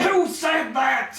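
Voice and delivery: high slow shout; mock outraged shout; mock indignant. Real, not acted. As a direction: playfully call out the joker.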